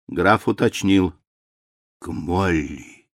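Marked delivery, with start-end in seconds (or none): 2.0-3.1 старческий голос. A narrator's reading. Старый граф отвечает.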